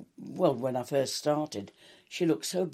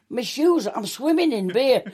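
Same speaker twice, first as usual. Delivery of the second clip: high, loudish comic protest; exaggerated dismay, pitched up; mock dismay; 8 semitones higher, 4 dB louder than usual. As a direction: wail it as a funny retold complaint. Retold dismay at beer-filled shoes.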